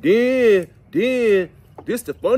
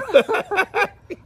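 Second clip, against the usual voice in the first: high laugh; 4 dB louder than usual. Real, not acted. Laughing at own joke.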